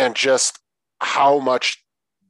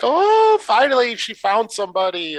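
much higher, louder gushing granny voice; delighted grandmother; 12 semitones higher, 5 dB louder than usual. Retold or imitated. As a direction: gush like a proud grandma. Voicing the pleased grandmother.

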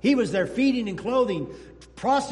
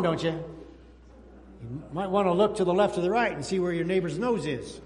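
usual speaking voice

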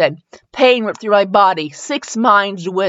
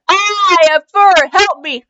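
loud, high panicked cry for help; screaming; 9 semitones higher, 5 dB louder than usual. Acted. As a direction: shriek it in acted horror. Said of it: "I screamed".

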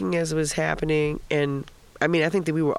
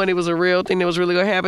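ordinary delivery

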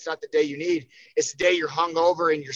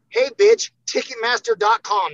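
much higher and louder, snapping at a friend; mock telling-off; 5 dB louder than usual. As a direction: blast the ticket-begging buddy. Mock telling off ticket-begging friends.

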